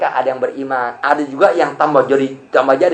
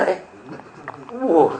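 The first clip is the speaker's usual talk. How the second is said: usual speaking voice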